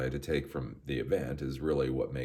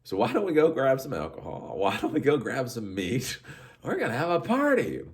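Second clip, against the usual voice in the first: excited party pitch; 10 semitones higher, 5 dB louder than usual. Retold or imitated. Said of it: "And it's like".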